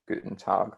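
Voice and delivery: Guten Tag in clear German; German demo. Shown as a demonstration. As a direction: model Guten Tag for the class. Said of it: "how I'm pronouncing that".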